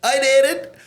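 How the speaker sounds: very high mimicked Cork brag; hammed-up high Cork lilt; Cork lad's catcall. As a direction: squeal it in a put-on Cork voice. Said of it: "He'd be like".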